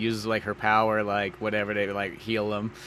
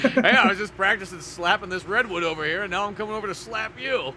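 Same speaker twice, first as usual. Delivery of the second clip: mock threat; 8 semitones higher, 5 dB louder than usual. Acting cocky slapper in retelling.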